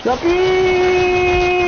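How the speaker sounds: very high squeal; shrieked line. Real, not acted. Fleeing gamer shrieking, very high. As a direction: squeal it out in panic. Panic peak while chased.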